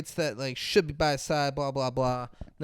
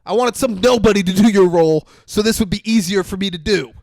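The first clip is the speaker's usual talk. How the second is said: loud, raised-pitch berating of an actor; mock director's tirade; 3 semitones higher, 10 dB louder than usual. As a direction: chew out the star, playing the exasperated director. Acted director berating his actor.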